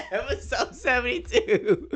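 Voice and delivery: breaking up with laughter; can barely get words out through laughs; laughing hard. Real, not acted. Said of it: "tears are rolling down my face".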